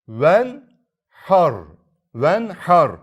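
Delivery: "ven har" recited loud with a heavy ra; thick ra recitation. Shown as a demonstration. Teacher reciting the example for students, loud.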